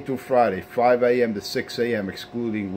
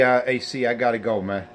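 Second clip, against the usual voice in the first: ordinary delivery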